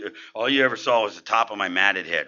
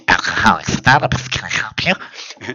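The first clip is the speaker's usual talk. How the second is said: a hint of a prim hotline lady; receptionist; 3 semitones lower than usual. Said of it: "she's like".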